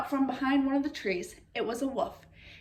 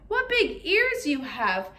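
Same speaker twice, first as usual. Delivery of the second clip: slow wonder; 2 semitones higher, 3 dB louder, slower than usual. Acted. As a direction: stretch it out in wonder. Girl marvels slowly.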